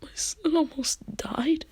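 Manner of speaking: high, slow, breathy tearful wail; stretched-out, whispery pretend sob; mock crying. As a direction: imitate a distraught mother in a thin whimper. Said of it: "she cries and she says".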